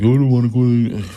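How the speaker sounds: mock a sulking teenager's refusal; fussy teenager. Teenage son refusing dad's food choice, fussy whine. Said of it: "Lewis was being really fussy".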